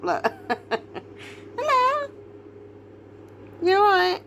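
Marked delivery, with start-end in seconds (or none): 1.6-4.2 very high, cooing hello; sing-song high-pitched check-in; high-pitched greeting. Real, not acted. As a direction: greet her in a tiny high voice. Greeting someone who looks up.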